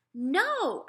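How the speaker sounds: put-on older brother refusing his mother, firmly; boy refusing. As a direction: put your foot down like a fed-up boy. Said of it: "I told her".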